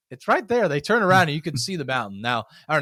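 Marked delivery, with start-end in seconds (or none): 0.1-0.7 pointing it out. Retold or imitated. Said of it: "I'm like".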